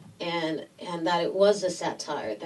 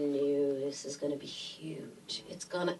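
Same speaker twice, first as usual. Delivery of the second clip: quiet conviction; 5 semitones lower, 10 dB quieter than usual. Retold or imitated. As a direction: drop right down, sure of it. Retold prediction to doubters.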